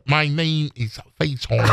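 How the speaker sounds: low, deliberate kung fu movie voice; Bruce Lee. Retold or imitated. Schoolboy answering his teacher, mock Bruce Lee voice. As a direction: imitate a martial-arts film hero. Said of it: "with the Bruce Lee voice".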